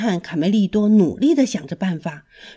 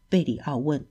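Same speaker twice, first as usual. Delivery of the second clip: ordinary delivery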